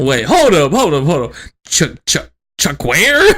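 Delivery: rapid repeats rising to a loud puzzled question; baffled interruption. Retold or imitated. Son stops mom over an odd word, baffled. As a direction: cut in, mock confused.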